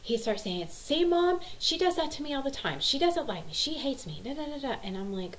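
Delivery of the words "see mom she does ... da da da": high-pitched tattling voice; imitated complaining child. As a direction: mimic a kid whining to mom. Ten-year-old complaining about sister.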